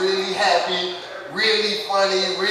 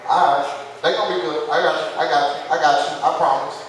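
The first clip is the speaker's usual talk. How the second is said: ordinary talk, nothing marked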